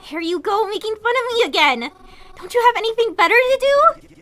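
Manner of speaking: hurt anger building. Acted. Mary snapping at Kroven's mocking, rising anger.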